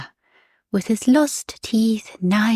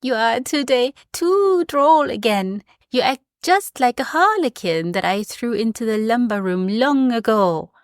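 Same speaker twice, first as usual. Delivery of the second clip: Fred teasing; 5 semitones higher than usual. Acted. Boy Fred teasing godfather.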